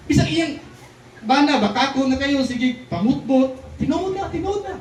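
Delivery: high, sarcastic wife voice; hammed-up wife calling her husband a liar; mock scolding wife. Wife mocking husband's lie. (nagbiaybiay nga asawa, taas nga tingog)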